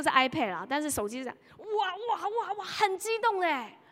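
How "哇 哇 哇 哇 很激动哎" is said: high, drawn-out imitation of a gamer's wows; mock excited cries. Mother imitating her gaming son's excited cries. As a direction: ape the child's wild excitement at the screen.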